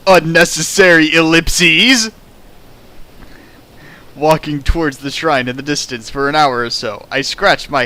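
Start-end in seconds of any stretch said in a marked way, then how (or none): 0.1-2.1 slow, louder proclamation of the error; mock outraged callout. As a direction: announce the flaw like a referee's call. Mock critic calling out the text.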